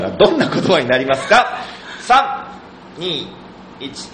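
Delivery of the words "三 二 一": countdown. Real, not acted. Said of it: "countdown for instant answer".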